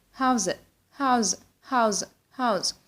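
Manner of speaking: "How's" ending in a buzzed Z; voiced Z in "How's", repeated as a drill; S pronounced as Z. Shown as a demonstration. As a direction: link "How's it" with a Z sound. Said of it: "S as the sound".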